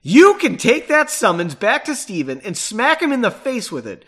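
forceful acted retort; loud retort. A narrator's reading. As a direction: fire it back loudly. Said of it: "Margot retorted loudly".

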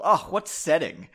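usual speaking voice

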